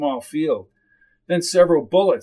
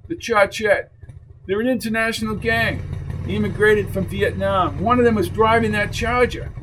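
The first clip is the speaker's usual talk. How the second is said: higher-pitched tough guy briefing his partner; pressing, raised-pitch character voice; tough biker uncle, urgent; 5 semitones higher than usual. Acted. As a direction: voice a biker naming the danger. Voices Dulio explaining the gang.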